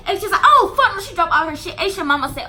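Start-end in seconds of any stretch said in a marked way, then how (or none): 0.4-1.0 high, loud outraged shout. Retold or imitated. Aisha hearing her friend was jumped, an outraged shout. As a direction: shout it in disbelief.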